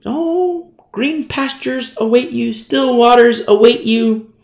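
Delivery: high, airy mock prophecy; false prophet. False prophet promising followers good things, mock prophetic. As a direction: float it high like a sweet promise. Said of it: "But when the false prophet".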